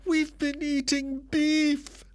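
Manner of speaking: high, aghast voice, last words slowed; horrified wife. Acted. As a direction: drag out the dreadful news. Wife delivers shocking news.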